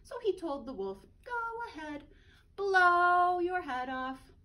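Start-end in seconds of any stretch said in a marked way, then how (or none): 1.2-4.3 slow, mocking dare; taunting pig. Third pig taunting the wolf, mocking dare.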